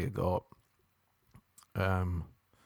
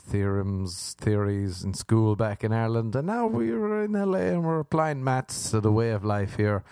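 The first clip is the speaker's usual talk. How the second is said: ordinary delivery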